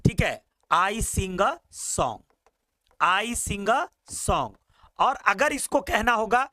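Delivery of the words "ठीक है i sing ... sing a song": each word spaced out for the class; slow, deliberate classroom demo; slow model answer. Shown as a demonstration. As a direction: spell the sentence out slowly. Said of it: "yes".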